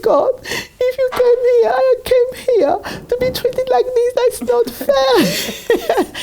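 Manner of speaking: high, slow, tearful wail; crying to God. Retold or imitated. Alone in her room after he left: high sobbing lament. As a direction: let the voice break into sobs. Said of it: "I started crying and crying".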